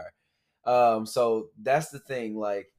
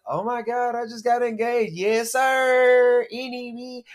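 put-on higher excited girl voice; imitating Megan; 10 semitones higher, slower than usual. Podcaster imitates Megan gushing about her engagement, higher voice. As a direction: gush like Megan showing off. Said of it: "Megan was like".

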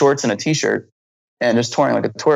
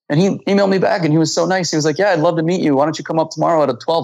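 excited retelling; 6 semitones higher than usual. Real, not acted. Thrilled to get the doctor's reply.

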